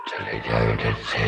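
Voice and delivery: very low, soft ghostly growl; deep ghost voice. Acted. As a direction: growl it like an angry spirit. Ghost tells them to leave. (भुतहा गहरी आवाज़ में)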